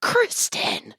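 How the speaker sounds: high, shocked cry of a name; mock gasp of a scandalized crowd; scandalized group shout. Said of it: "The whole salon collectively said".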